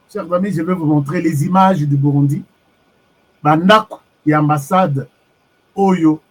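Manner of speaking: ordinary talk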